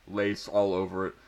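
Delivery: usual speaking voice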